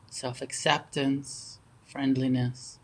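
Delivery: unhurried meditation guidance with long pauses between phrases; guided meditation. Real, not acted. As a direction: guide them gently into stillness, phrase by phrase.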